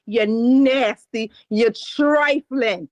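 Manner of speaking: slow, drawn-out disgust; slow scolding. Acted. Advice host scolding a listener, slow and disgusted. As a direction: lean on each word like a verdict. Scolding the letter writer's choice.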